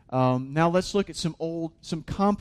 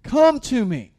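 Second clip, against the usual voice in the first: raised, louder preaching voice, pushing hard; impassioned preaching; 8 semitones higher, 8 dB louder than usual. Acted. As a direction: preach it out with full force as God's invitation. Preached invitation.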